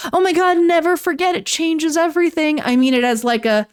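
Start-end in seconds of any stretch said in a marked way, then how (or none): 0.1-2.6 mock dramatic. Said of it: "I don't mean to say that in, like, a".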